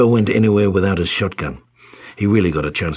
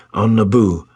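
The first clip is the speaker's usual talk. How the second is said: plain reading voice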